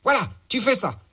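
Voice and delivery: a crude boss giving orders; gangster boss. Acted. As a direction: boss bossing his henchman around. Boss character voiced in story.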